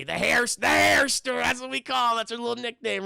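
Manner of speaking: high, loud, overplayed joviality; hammed-up buddy-buddy boss; fake chummy boss. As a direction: stretch the nickname out with phony fondness. Boss's fake chummy nickname act.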